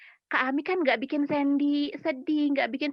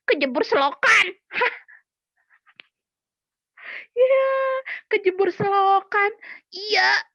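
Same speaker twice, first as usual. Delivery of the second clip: put-on higher and louder, a little child whining; whiny puppet child; 7 semitones higher, 5 dB louder than usual. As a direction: play the upset puppet kid. Puppet Sandy whines.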